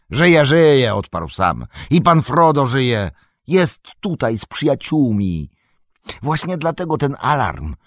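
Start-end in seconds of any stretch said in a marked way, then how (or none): 0.1-0.9 high, loud reassurance; eager reply. A narrator's reading. Sam tells Cotton he is alive, eagerly. 1.9-7.7 higher, excited announcement; eager report. A narrator's reading. Sam tells Cotton Frodo has returned, eagerly.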